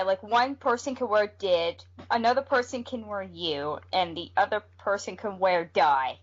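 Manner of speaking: ordinary talk, nothing marked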